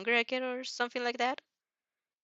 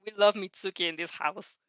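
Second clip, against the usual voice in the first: usual speaking voice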